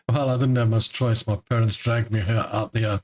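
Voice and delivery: ordinary delivery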